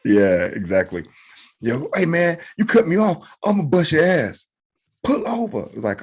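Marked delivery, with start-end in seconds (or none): 1.6-4.5 high-pitched comic road-rage challenge; mock tough threat. Driver confronting the man who cut him off, mock tough. 5.0-5.7 very high-pitched mock challenge.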